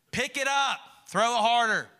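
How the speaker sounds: high, exasperated coaching-dad voice; frustrated dad. Acted. Dad drilling son at throwing a ball, frustrated. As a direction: order the kid around impatiently. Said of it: "he's frustrated again".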